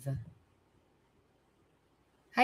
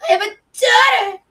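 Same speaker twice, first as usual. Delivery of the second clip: mocking whiny voice; 13 semitones higher, 10 dB louder, much faster than usual. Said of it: "he was mocking me, going".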